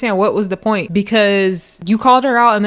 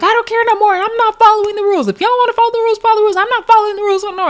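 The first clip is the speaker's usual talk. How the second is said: mock defiant yelling; 13 semitones higher, much faster than usual. DTB ranting while getting into the sprinter, high mock shout. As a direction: fire it off like a rule-breaker. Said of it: "still on level one thousand".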